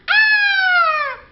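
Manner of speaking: very high loud playful shriek; mock scare shriek. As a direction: squeal to frighten the mouse. Cat frightens the mouse.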